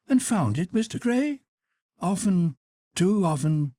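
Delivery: high Duchess voice. Acted. Duchess challenging Lord Henry, high and teasing. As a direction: play the witty Duchess, high and bright.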